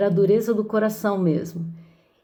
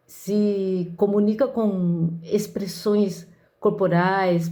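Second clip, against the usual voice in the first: usual speaking voice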